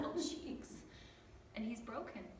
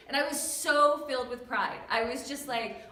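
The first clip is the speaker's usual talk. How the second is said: ordinary delivery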